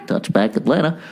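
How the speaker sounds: lightly voiced PA call; tossed-off PA announcement; lightly imitated announcer; a hint of the stadium announcer. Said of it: "the announcer just goes".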